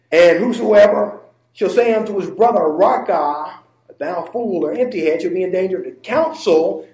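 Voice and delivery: loud reading that leans on "Raca" and "council"; declaimed verse, "Raca" set off on its own; emphatic scripture inflection. Shown as a demonstration. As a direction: read the verse out with weight on "council". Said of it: "Now listen to the inflection again".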